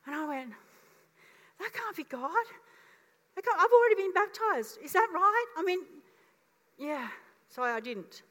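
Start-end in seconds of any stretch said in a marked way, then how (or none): 1.6-7.1 high, disbelieving protest; can't believe what you're hearing; a flustered double-take; incredulous. Retold or imitated. Retelling own doubting reply.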